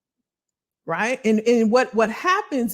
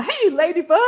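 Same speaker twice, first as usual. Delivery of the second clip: giggly higher hello; laughing greeting; 9 semitones higher, 3 dB louder than usual. Real, not acted. Greeting a favourite viewer, laughing. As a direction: greet her through a laugh.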